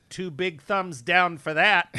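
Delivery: heckler yelling. Retold or imitated. Middle-aged heckler yells at the comic, mock heckle. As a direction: drag out a smug heckle. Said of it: "this woman yells out".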